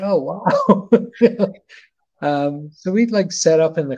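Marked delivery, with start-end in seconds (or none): 0.0-1.5 high, loud amazed gasp into a laugh; be floored, then laugh; awed wow. Retold or imitated. Photographer seeing the huge hangar, awed and laughing.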